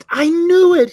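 high, loud triumphant cry; excited exclamation. Retold or imitated. Lady in line catching him, loud high triumph. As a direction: belt it out like you caught him. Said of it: "She goes".

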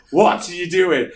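mock-exasperated wife asking; wife's voice.

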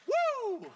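high, loud, shouted whoop; joyful whoop. Real, not acted. Pastor cheering as the church stands, loud ecstatic whoop. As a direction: let out an ecstatic high woo. Congregation standing, sermon climax.

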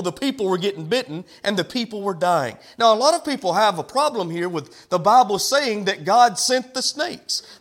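normal talking voice

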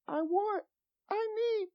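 put-on high, soft little-kid begging; whiny child voice. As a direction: play a small child pleading with daddy. Mimics child's demand again.

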